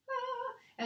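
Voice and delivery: pained high ah; strained high exclaim. Real, not acted. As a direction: yelp from the roller. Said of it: "funny noises".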